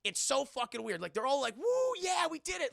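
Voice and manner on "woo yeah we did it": high whooping celebration; hammed-up cheer; mock cheer. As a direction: whoop in triumph. Retold cheering.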